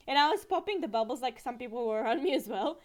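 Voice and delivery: ordinary talk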